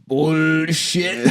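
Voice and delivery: hammed-up film hero, tipping into laughter; Arnie impression. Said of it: "the way Arnie goes".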